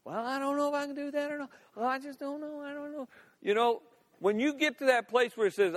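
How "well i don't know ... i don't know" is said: high, soft, whiny waverer; doubting. Acted. A doubter sitting around, brought down by doubt, dithering. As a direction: dither in a small high voice, fading away. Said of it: "doubt can just bring you down".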